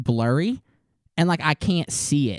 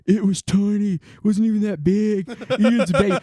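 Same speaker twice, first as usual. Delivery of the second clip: mocking brother; 4 semitones higher, faster than usual. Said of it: "he'll be like".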